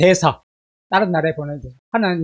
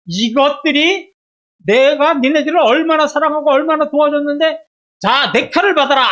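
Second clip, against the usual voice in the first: high, raised-voice indignant outburst; mock divine wrath; 7 semitones higher, 4 dB louder than usual. Preacher imagining himself as God drawing the sword of judgment, indignant. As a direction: play an exasperated God losing patience. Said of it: "제가 하나님이라면".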